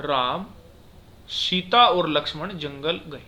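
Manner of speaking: slow reading with a clear pause after Ram; comma pause. Shown as a demonstration. Teacher shows a comma's short stop, pausing after Ram. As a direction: set Ram apart, then slowly say Sita और Lakshman. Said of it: "थोड़ी थोड़ी देर रुक के बोल रहे हैं".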